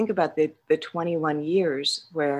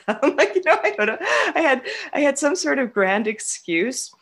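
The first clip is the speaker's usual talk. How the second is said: laughing; 11 semitones higher, 4 dB louder than usual. Real, not acted. Laughing at old excuses.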